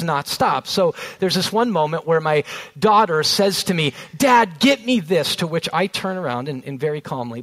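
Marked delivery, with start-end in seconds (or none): 4.2-5.4 put-on higher, slow whiny child's demand; demanding little girl. Preacher voicing his daughter demanding something, mock whiny child. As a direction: play the bossy little daughter.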